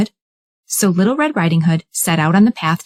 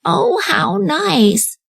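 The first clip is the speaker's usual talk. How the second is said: high, slow delighted granny; drawn-out sweet old lady; old grandmother; 11 semitones higher, much slower than usual. Acted. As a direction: grandmother pleased at her granddaughter's visit. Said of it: "said grandmother".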